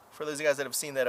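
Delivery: usual speaking voice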